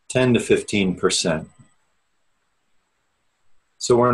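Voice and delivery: measured, unhurried meditation guide with long pauses; steady, spacious meditation-teacher delivery; guided meditation. Real, not acted. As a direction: ease listeners inward, leaving space after each phrase. Leads an opening meditation.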